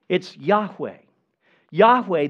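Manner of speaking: proclaiming Yahweh; stress on Yahweh. Real, not acted. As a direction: land hard on the second Yahweh. Preacher stresses the holy name.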